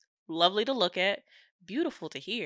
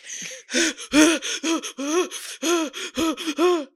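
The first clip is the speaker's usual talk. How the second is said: gasping for air, higher; mock panicked gasp; 9 semitones higher, 4 dB louder than usual. She reenacts gasping as waves hit her face, higher. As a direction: act out choking on a wave. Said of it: "So I'm like".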